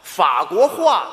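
法国话 spelt out slowly as fa guo hua; slow correction. Shown as a demonstration. Student correcting the teacher, slow careful 法国话. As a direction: say 法国话 slowly, each syllable distinct.

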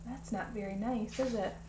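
put-on soft, disapproving question to the kids; hushed aside. A narrator's reading. Reader asks the children, a hushed mild rebuke. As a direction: murmur it gently, inviting agreement.

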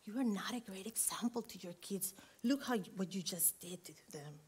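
a lightly voiced tempter murmuring lies; the enemy's whisper. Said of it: "He is an expert of whispering to us".

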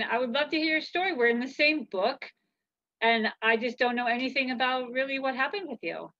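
usual speaking voice

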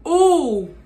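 high loud ooh at the heat; excited ooh. Real, not acted. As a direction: yelp at the spice. Reacting to hot nugget.

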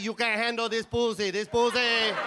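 put-on in-your-face Puerto Rican accent; mock Puerto Rican woman's accent, pushy; aggressive Puerto Rican accent. Comic imitating Puerto Rican women, aggressive Puerto Rican accent. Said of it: "your accent is a little aggressive".

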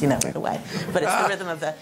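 ordinary talk, nothing marked

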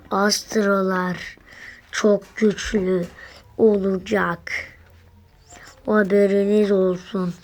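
ordinary talk, nothing marked

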